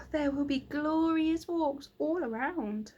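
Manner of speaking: put-on child voice. Child Franny voiced in story.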